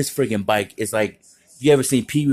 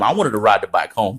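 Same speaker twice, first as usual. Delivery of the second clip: normal talking voice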